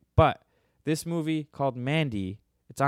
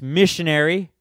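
loud; 5 semitones higher, 9 dB louder than usual. Real, not acted. Punchline, said louder.

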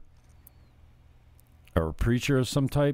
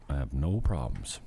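normal talking voice

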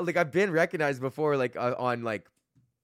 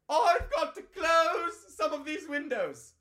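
much higher, slow put-on fussy voice; mock fussy host; 14 semitones higher, slower than usual. A comic punning on closing windows in a fussy voice. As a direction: drag it out like a fussy old worrier.

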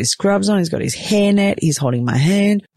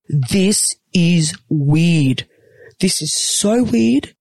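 stunned disbelief; 3 semitones higher, much slower than usual. Retold or imitated. Mother staring at newborns in disbelief.

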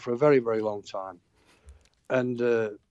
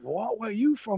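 high-pitched retold astonishment; acting out a startled double take; surprised question; 10 semitones higher than usual. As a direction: pitch it up in mock amazement. All turn round amazed at the accent.

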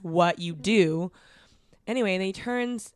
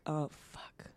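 low-voiced, sinking aside; retold curse under the breath; dismayed muttering; 3 semitones lower, 12 dB quieter than usual. Woman dreading the nearby diners, quiet dismay. Dread on seeing the loud men.

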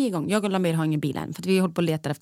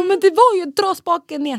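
high, loud, defensive retort; hot-headed; 11 semitones higher, 6 dB louder than usual. Retold or imitated. Driver defending herself to partner on FaceTime, hot-headed. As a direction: re-enact snapping back in a raised voice. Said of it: "du är så hetsig".

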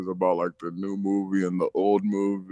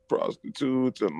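normal talking voice

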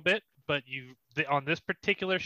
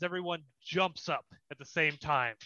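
ordinary talk

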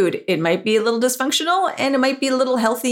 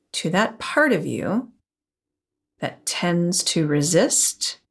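guided meditation. Acted. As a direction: calmly guide the listener inward. Leads a guided visualization.

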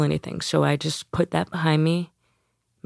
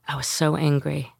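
ordinary talk, nothing marked

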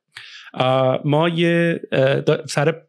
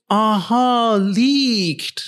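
imitating a colleague; 5 semitones higher, much slower than usual. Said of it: "یارو گفت".

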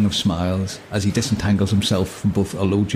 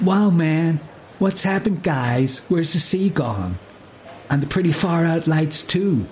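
higher, slow spaced-out hippie voice; dazed hippie; 5 semitones higher, slower than usual. Acted. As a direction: float it like a stunned stoner. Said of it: "he says".

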